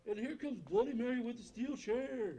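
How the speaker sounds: booming, slow ring announcer; WWE announcer voice. Acted. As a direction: hype the entrance like a wrestling MC. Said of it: "W W E announcer voice".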